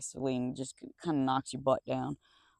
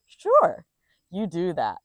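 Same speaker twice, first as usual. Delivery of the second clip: sarcastic; 3 semitones higher, 7 dB louder, slower than usual. Real, not acted. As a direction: brush them off with sarcasm. Sarcastic dismissal of viewer.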